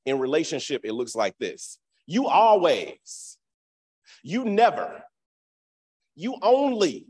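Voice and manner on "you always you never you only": long, drawn-out high accusing swoop; exaggerated accusation. Partners blaming each other, drawn-out mock accusation. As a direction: stretch the key word, loud and accusing.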